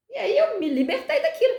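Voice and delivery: ordinary talk, nothing marked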